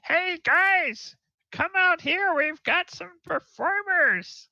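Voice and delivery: high falsetto call to friends; loud, very high-pitched summons; gnome yelling. Acted. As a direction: holler excitedly in a tiny gnome voice. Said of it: "you hear one of 'em yell".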